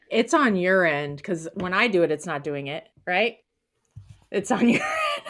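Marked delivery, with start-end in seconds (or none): 4.3-5.2 breaks into a high laugh mid-line; giggling while teasing her co-host. Real, not acted. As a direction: laugh through the last word. Teasing about the thumbs up.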